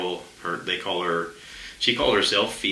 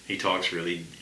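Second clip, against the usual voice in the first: ordinary talk, nothing marked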